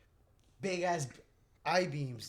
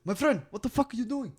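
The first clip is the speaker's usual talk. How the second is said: higher, louder boss voice; manager scolding; 8 semitones higher, 10 dB louder than usual. Retold or imitated. Imitating the manager catching him, loud scolding. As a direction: play the angry Indian manager. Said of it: "he goes".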